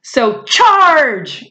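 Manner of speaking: high battle cry; yell. Acted. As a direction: charge forward with a yell. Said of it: "yelled a squadron".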